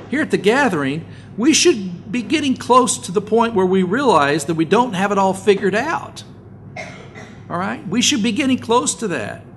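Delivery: loud, high fervent preaching. Acted. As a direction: drive it home to the congregation. Preaching at full force.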